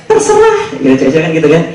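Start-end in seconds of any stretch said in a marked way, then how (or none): 0.1-0.6 high mock-girlfriend voice, much higher than his own; girly falsetto. Hungry girlfriend answering her boyfriend, in high mock falsetto. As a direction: squeak it like a whiny girlfriend.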